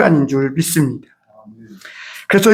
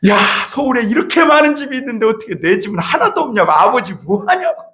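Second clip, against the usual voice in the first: high, raised complaint at God; retold shout; 5 semitones higher than usual. Young preacher yelling on the prayer mountain, high shout. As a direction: throw the question up at heaven. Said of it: "청계산 기도원에 올라가갖고".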